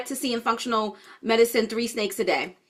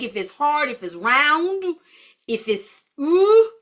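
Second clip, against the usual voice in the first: usual speaking voice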